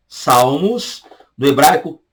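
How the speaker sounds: usual speaking voice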